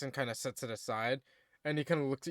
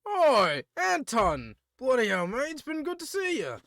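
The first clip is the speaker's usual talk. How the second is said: loud, much higher British greeting; British dwarf; 10 semitones higher, 6 dB louder than usual. Acted. Dwarf blacksmith greeting Anton, loud British cheer. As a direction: hail an old friend in a hearty British accent. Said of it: "he looks at you and goes".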